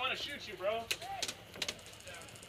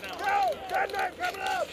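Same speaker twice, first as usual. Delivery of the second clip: shouting; 8 semitones higher, 7 dB louder than usual. Real, not acted. Calling out warning in firefight.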